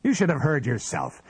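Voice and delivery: high-pitched angry accusation; almost screaming. A narrator's reading. Husband accuses wife over her sleep-talk, almost screaming. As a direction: accuse with rising fury. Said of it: "he almost screamed".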